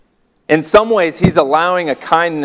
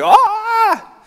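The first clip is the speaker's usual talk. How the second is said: hammed-up startled cry, pitched way up; mock scream; 8 semitones higher, slower than usual. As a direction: play a startled scream, then a high-pitched question. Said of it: "She screams like".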